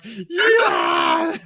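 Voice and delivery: loud, high drawn-out scream; mock scream. Friend acting out the circumcision victim, mock scream. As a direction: let out a high, hammed-up yell.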